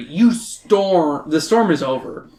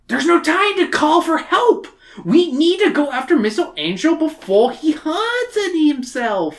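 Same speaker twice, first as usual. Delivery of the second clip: very high, urgent hammed-up hero voice; overacted heroine; 13 semitones higher than usual. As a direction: urge them on in a hammy high voice. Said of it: "What the fuck was that?".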